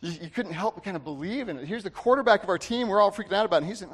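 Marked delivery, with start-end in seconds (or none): none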